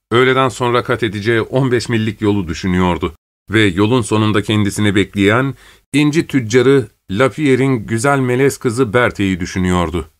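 usual speaking voice

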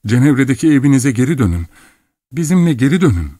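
high, pressing entreaty; urgent pleading. A narrator's reading. Daughter urges her father to come home, pleading.

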